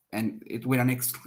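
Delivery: usual speaking voice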